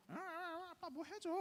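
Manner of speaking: high-pitched little kid pleading, mimicked; whiny child voice. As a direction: play a small child tugging at dad. Little son begging dad.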